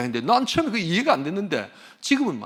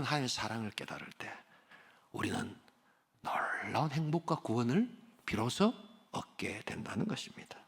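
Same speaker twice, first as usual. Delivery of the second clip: softer, hushed whisper of wonder; 6 semitones lower, 11 dB quieter than usual. Real, not acted. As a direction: breathe it out as a quiet secret. Preacher whispers the climax. (속삭이듯 경이롭게)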